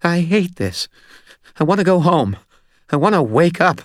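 high-pitched, distressed whimper; frightened little guy close to breaking; miserable fear. A narrator's reading. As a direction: plead in a high, shaken voice. Sick, shaking Butters wants out.

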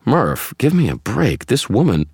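high, loud, incredulous objection; exasperated protest. A narrator's reading. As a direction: protest in exasperation until interrupted.

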